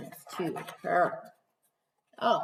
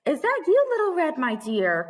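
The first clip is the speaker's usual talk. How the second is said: higher, louder call; Granny calling; 6 semitones higher, 3 dB louder than usual. Acted. Granny calling through her door, higher and louder. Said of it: "called Granny".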